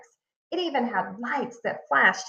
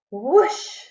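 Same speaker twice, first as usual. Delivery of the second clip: excited whoosh; 3 semitones higher, 7 dB louder than usual. A narrator's reading. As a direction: blast it out like rushing water. Sound effect in picture book.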